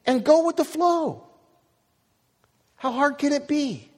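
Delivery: high, urgent preaching; impassioned preaching. Acted. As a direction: drive the message home to the church.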